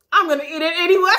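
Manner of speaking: high, loud, fast giddy resolve; loud giddy "eat it anyway"; gleeful defiance. Retold or imitated. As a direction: blurt it out, giving in to temptation. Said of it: "I was just like".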